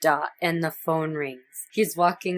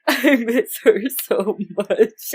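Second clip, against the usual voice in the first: slow, louder, overdone teary whine; mock fake crying; 5 semitones higher, 5 dB louder than usual. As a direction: ham up a badly faked sob. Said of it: "the worst fake crying".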